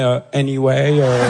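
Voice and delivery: slow, low, drawn-out hesitation into English; stretched, affected franglais; girls dropping English. Acted. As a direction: drag out the English word like a show-off. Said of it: "Elles sont comme ça".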